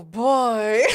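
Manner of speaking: loud exclamation. Real, not acted. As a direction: blurt it out in disbelief. Reacting to nude request.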